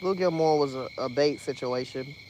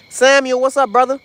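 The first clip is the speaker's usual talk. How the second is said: loud, higher hail to a friend; calling out; 9 semitones higher, 10 dB louder than usual. Real, not acted. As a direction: shout a greeting across.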